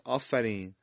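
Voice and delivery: ordinary talk, nothing marked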